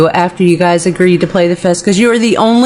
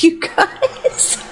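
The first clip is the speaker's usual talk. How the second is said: breathy laugh through hiccups; giggling while hiccuping; 9 semitones higher, 6 dB quieter than usual. Real, not acted. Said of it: "laughing at my hiccups".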